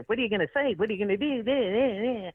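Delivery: high, singsong mock nagging; pestering blabber. Other comic blabbing in her ear, high mock pestering. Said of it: "he's blabbing in my ear".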